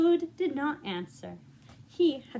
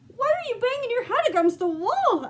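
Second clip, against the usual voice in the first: higher, louder, amazed question; incredulous Frog; 11 semitones higher, 7 dB louder than usual. Acted. Frog gaping at Toad banging his head, astonished. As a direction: ask it in wide-eyed disbelief.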